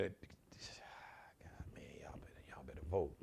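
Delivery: soft urgent whisper. Real, not acted. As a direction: lean in and whisper the warning. Urgent aside to viewers.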